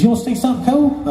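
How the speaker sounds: quick, high-pitched boss voice; Charlie. Acted. Boss about to show off the famous mandolin, high-pitched. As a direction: offer it fast in a high caricature. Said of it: "high-pitched voice".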